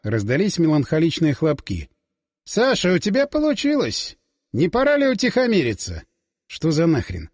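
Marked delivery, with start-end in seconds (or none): 2.4-6.0 a lightly voiced character, pitched higher; Бурденко. A narrator's reading. Older mentor telling Sasha to calm down, lightly chiding. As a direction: chide him from a raised pitch. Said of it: "Это однозначно голос Бурденко".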